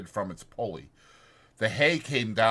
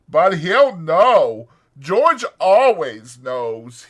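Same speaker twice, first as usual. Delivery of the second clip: loud, slow, sorrowful lament; 7 semitones higher, 12 dB louder, much slower than usual. A narrator's reading. Lenny mourns to the puppy that George will know, loud and sorrowful. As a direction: drag it out in grief. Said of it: "He went on sorrowfully".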